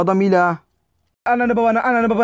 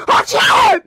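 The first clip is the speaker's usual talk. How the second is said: very high, loud scream for the referee; screaming at the ref; 6 dB louder than usual. Real, not acted. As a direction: shriek it at the ref in outrage. (hakeme çığlık atarak)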